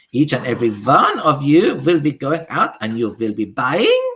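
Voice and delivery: high, loud witch voice with a foreign lilt; high imperious witch decree; Grand High Witch. Acted. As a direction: command the witches like a tyrant queen.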